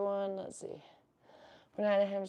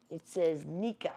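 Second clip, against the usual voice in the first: ordinary talk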